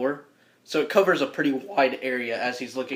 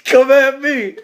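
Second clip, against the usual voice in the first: loud, high fighter's taunt; amped-up challenge; 9 semitones higher, 9 dB louder, slower than usual. Acted. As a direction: dare them with a raised voice. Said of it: "he's over there super amped".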